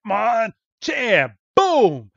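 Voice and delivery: high, loud, slow shouted cry; drawn-out cartoon fighter yell; battle cry. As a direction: bellow it like a fighter announcing his punch. Hitmonchan's battle cry punch.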